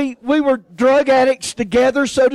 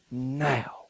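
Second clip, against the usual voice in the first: quiet inner voice; 10 semitones lower, 13 dB quieter than usual. Retold or imitated. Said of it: "And God said".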